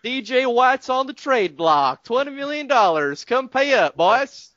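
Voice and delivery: high, hammed-up Southern drawl selling a trade; cartoonish Southern voice, drawn out and squeaky; mock Southern drawl. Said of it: "that accent".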